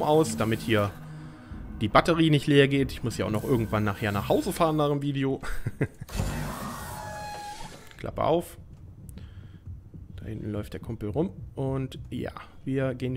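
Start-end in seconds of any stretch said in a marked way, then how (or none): 5.5-7.7 high squealing burst of laughter. Real, not acted. Big laugh after joke.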